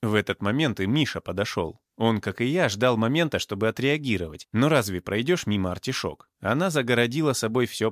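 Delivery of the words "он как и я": ordinary delivery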